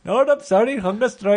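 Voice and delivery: high-pitched put-on Indian accent; mock Gandhi accent. Comic correcting the co-host's Gandhi, high Indian accent. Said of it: "where's your accent?".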